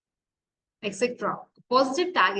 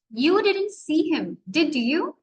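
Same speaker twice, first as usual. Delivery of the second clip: higher voice, "did you" lifting at the end; rising intonation; 3 semitones higher, slower than usual. Shown as a demonstration. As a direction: uncertain question, "did you" climbing to seek confirmation. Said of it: "example of rising intonation".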